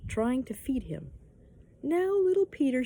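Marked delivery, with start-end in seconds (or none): 1.8-2.8 higher-pitched coaxing voice; coaxing mother. A narrator's reading. Mother tries to feed little Peter, coaxing. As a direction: wheedle a small child.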